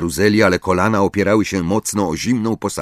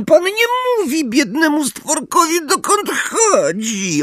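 high creature voice, dragging the ending; house-elf voice. A narrator's reading. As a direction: answer as a squeaky, put-upon elf. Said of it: "odpowiedział cicho skrzat". (wysoki, przeciągły głos skrzata)

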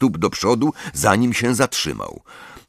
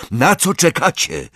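raised, higher urgent cry; roar; 8 semitones higher than usual. A narrator's reading. Harry to his frozen friends, urgent roar. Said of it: "ryknął".

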